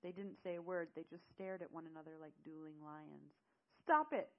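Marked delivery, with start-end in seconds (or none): 3.8-4.3 high, loud desperate shout; crying out. Acted. Woman to two men squaring up, crying out. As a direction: cry out to break up a fight. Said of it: "I cried out to them".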